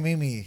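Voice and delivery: "meme" in two syllables, MEE-mee; favored mispronunciation of meme. Real, not acted. Friend defending his way of saying it, "meme" as MEE-mee. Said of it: "But I like saying meme".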